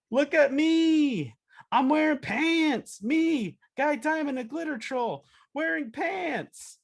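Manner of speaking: very high, drawn-out boastful cartoon voice; show-off troll. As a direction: crow it to the whole marketplace, stretching the words. Acting troll showing off his pants.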